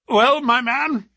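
high, loud, drawn-out hail; hearty greeting. A narrator's reading. Trevor greeting the sailor on the lawn, loud and hearty. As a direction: call it out slowly, high and loud.

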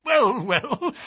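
high, hesitant stalling; nervous fashion. A narrator's reading. Patient dodges the detective's question, nervous high voice. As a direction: hedge nervously on both words. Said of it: "said the resident patient in a nervous fashion".

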